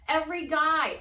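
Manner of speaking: ordinary talk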